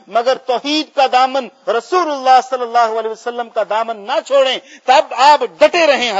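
loud, high, rousing preacher's delivery; impassioned exhortation. Retold or imitated. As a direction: drive each sacrifice home at full force. Boy's answer preached at full force. (پرجوش، بلند آواز میں خطیبانہ للکار)

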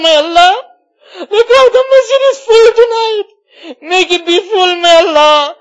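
loud, high, crying supplication; tearful pleading prayer. Real, not acted. Imam begging Allah for guidance after Ramadan, tearful pleading.

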